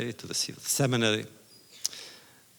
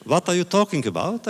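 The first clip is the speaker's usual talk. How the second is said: incredulous protest; 7 semitones higher, 5 dB louder than usual. Retold or imitated. Lieberman protests, taken aback.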